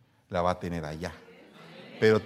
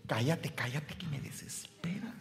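harsh whispered hush, higher; exasperated whisper; 4 semitones higher, 11 dB quieter than usual. Retold or imitated. Mother hushing her child, exasperated whisper. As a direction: whisper it like a fed-up mother shushing. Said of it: "O como dijo Primera de Kiko".

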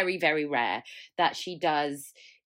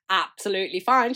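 absolutely fine repeated louder with pointed sarcasm; mock sarcastic; 6 dB louder than usual. Shown as a demonstration. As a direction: throw absolutely fine back, mocking yourself. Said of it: "she says".

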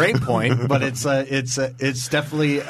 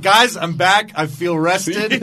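imitating her; 6 semitones higher, 3 dB louder, much slower than usual. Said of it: "she's like".

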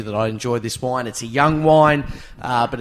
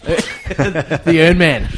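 laughing; 5 semitones higher, 6 dB louder, much slower than usual. Real, not acted. Laughing at the Ernie mix-up.

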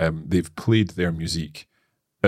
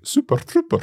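high, loud Glaswegian accent; 3 dB louder than usual. Shown as a demonstration. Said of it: "in a very Glaswegian accent".

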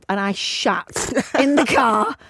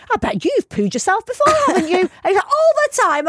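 high, jolly posh colleague voice; posh businessmen; 10 semitones higher, 3 dB louder than usual. Retold or imitated. Dad's colleagues reassuring a child, high jolly posh voice. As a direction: play it like cheery men in suits. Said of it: "saying to the other one".

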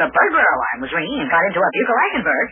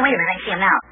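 ordinary delivery

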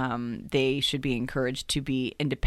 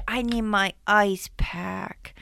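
put-on child voice; 6 semitones higher, much slower than usual. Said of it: "he'd say".

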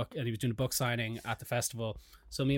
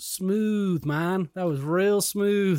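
higher, slow sarcastic praise; sarcastic drawl; 5 semitones higher, 5 dB louder, much slower than usual. Retold or imitated. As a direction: drag it out, mocking the fan. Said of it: "And then he goes".

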